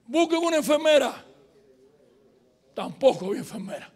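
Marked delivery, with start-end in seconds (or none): 0.1-1.2 raised, louder call for help; urgent call. Retold or imitated. Professor seeking help for a sick student, acted urgent call. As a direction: re-enact an urgent shout to bystanders.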